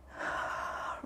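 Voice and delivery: breathy, unvoiced yawn; a big real yawn slipping out. Real, not acted. Instructor yawns while class stretches, real yawn. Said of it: "Sorry for yawning".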